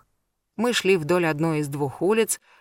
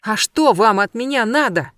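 shouted out, higher and louder; too loud outburst; 4 semitones higher, 5 dB louder than usual. A narrator's reading. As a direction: blurt it out far too loudly. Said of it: "чересчур громко выкрикнула".

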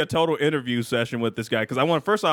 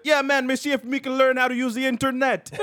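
higher mock Jamaican accent; 9 semitones higher than usual. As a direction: play a Jamaican rapper in a Jamaican accent. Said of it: "He's like".

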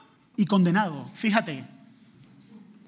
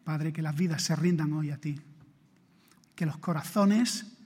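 fervent prayer; 5 dB louder than usual. Real, not acted. Closing prayer.

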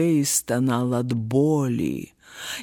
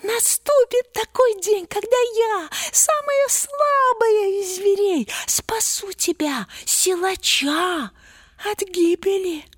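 very high squeaky mouse voice; earnest small mouse promise; tiny mouse voice. A narrator's reading. Mouse insists.

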